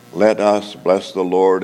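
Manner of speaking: usual speaking voice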